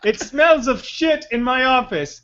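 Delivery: hammed-up indignant authority; disgusted commissioner. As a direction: drag out the complaint in revulsion. Commissioner complaining of smell.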